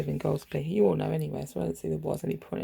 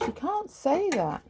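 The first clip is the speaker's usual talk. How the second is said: higher and slow, drawn-out outrage; indignant disbelief; 10 semitones higher, slower than usual. Real, not acted. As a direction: stretch it out in disbelief. Outraged at what was said.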